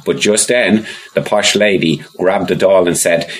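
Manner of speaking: ordinary delivery, nothing marked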